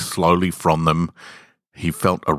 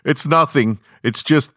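calling back into the house, higher; raised voice yell; 10 semitones higher, 3 dB louder than usual. A narrator's reading. Harry yells back into the house, voice raised. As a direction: call it out toward the house. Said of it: "He raised his voice to yell".

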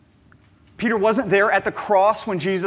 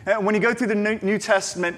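ordinary talk

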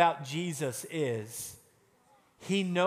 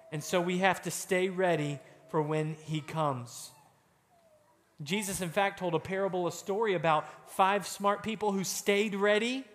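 usual speaking voice

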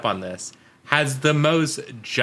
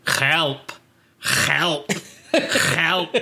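odd accented cry for help, raspy onset; spaced-out, throaty comic pleas; President Gomez; much slower than usual. Shown as a demonstration. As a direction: imitate a cartoonish accented "help". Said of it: "Okay, I'm gonna do it".

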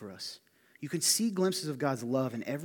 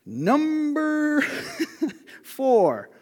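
high, loud mock announcer call; mock announcer booming "Number four"; 14 semitones higher, 10 dB louder, much slower than usual. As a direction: boom it out like a game show host. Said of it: "out of announcing something".

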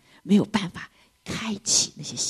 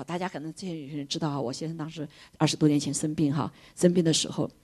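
normal talking voice

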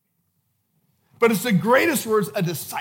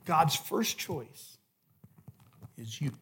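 soft, low, intimate sermon voice; hushed preaching; 3 semitones lower, 5 dB quieter than usual. Acted. Preacher quietly urging each disciple, hushed and intimate. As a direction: lean in and speak softly to each listener.

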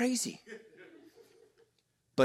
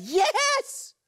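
mock cheerful yes. Older brother mock answer.

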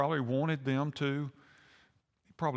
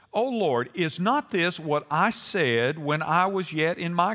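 scripture reading; 2 semitones higher, 3 dB louder, slower than usual. Acted. Jonah complaining to the Lord, declaimed scripture reading. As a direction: declaim it with weighty, drawn-out phrases. Reads Jonah's prayer aloud.

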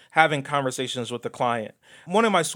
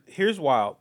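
ordinary talk, nothing marked

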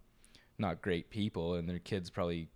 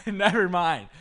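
laughing; 8 semitones higher, 9 dB louder, slower than usual. Real, not acted. Still laughing at mistake.

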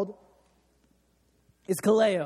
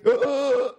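mock gasp for air; 3 semitones higher, 6 dB louder than usual. Retelling nearly drowning.

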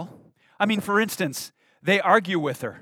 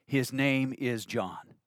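slow, solemn; 4 semitones lower, 7 dB quieter, slower than usual. Shown as a demonstration. Repeated for emphasis.

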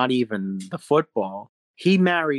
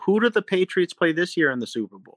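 lightly voiced little kid, higher; 8 semitones higher, 4 dB louder than usual. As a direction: ask it like an eager little kid. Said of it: "hearing a little kid say".

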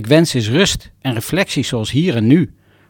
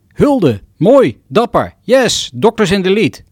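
bright, loud cheering, higher and louder; enthusiastic praise; 6 semitones higher, 4 dB louder, slower than usual. Retold or imitated. Friends praising his new boardroom job, loud and excited. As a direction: cheer him on like a proud friend.

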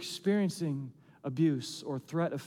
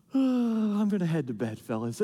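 casual David. Acted. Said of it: "and he just goes".

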